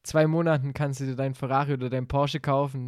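usual speaking voice

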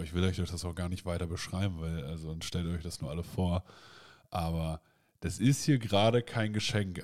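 ordinary talk

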